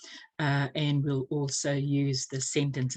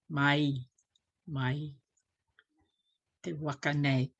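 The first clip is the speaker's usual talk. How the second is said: usual speaking voice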